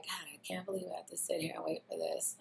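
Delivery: fast, whispered annoyed thought; the girlfriend's annoyed inner voice; whispered complaint. Retold or imitated. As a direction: grumble it under your breath. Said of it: "And I'm like".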